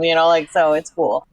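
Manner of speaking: normal talking voice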